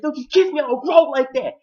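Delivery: high, loud mock outrage; mad. Man objects to the kiss, high and mad. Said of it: "he was mad".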